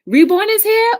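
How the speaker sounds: loud, high showman call; hype announcement. Real, not acted. Opening the live stream to viewers, loud and high. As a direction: announce the show big and high.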